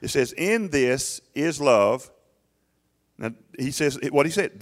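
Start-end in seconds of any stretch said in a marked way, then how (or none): 0.3-2.1 slow scripture reading. Shown as a demonstration. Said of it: "reads scripture slowly".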